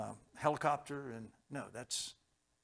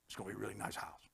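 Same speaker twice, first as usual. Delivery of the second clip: wry joke; 6 semitones lower, 11 dB quieter, faster than usual. Acted. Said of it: "It's just a joke".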